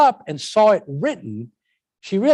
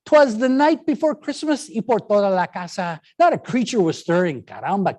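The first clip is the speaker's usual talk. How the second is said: higher, lively mixed-language recitation; poem reading; 6 semitones higher than usual. Shown as a demonstration. Teacher reading a code-switched poem aloud, lively. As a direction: read it with Spanish flair. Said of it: "But I'll read it for you".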